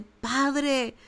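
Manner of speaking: a hint of an anguished Jesus in the garden; Jesus praying. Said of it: "Y es donde Jesús dice".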